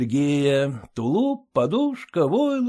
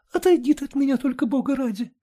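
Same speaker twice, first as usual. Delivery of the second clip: quick, rushed plea; hurried; 10 semitones higher, faster than usual. A narrator's reading. Wife hurriedly begs Sergei to step away, hurried. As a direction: rattle it off in a hurry. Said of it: "торопливо проговорила Алена Ивановна".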